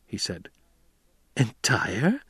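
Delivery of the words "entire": shocked. A narrator's reading. Jessica hearing Paul's dowry demand, shocked. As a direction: echo the word, almost speechless. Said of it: "She was shocked, almost speechless".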